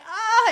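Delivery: loud, high crying "ah"; student in tears at her lesson, a loud high wail; mock anguished wail. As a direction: cry out like the upset student. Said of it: "She's like".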